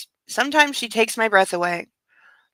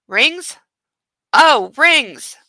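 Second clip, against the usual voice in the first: dawning surprise, higher; oh-no realisation, raised and louder; sudden realisation; 4 semitones higher, 5 dB louder, much slower than usual. Acted. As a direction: realise with a jolt. Anna's realisation.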